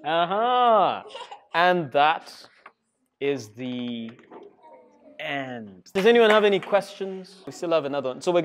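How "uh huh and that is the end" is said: put-on lively, sing-song read-aloud for kids; animated storybook narration; reading to children. A narrator's reading. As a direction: tell it like a storybook to a class. Reads picture book to children.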